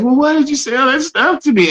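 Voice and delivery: hurt, tearful question. Retold or imitated. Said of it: "And started bawling".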